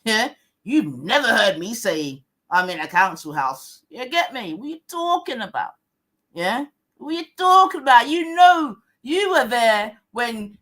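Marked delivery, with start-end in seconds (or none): none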